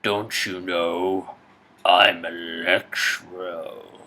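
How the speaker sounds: very slow, drawn-out menacing villain voice; slow eerie villain drawl; Electro villain. Acted. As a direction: stretch every word like a brooding supervillain. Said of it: "The Amazing Spider-Man Two".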